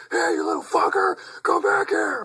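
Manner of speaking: put-on high, loud furious shout at a kid; angry yell. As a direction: bellow it after a fleeing kid, voice cracking high. Angry driver yelling at kids.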